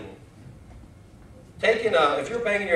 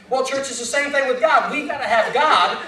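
ordinary delivery

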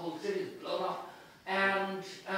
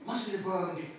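raised, loud performed reading of Bloom's thoughts; dramatic reading; 4 semitones higher, 5 dB louder than usual. Acted. Reading Bloom's end aloud to an audience, performed and loud. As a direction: read it as a rising musical finale. Reads Bloom's musical ending.

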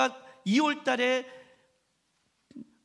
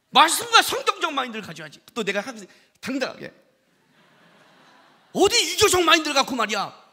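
higher, louder mock scolding wife; wife's scolding; 4 semitones higher, 6 dB louder than usual. Pastor imitating his wife scolding him. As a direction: nag him like a wife who is teasing.

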